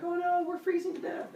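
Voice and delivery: very high silly voice; narrating a silly video in a squeaky voice; squeaky caveman. Acted. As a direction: whine like a cartoon caveman.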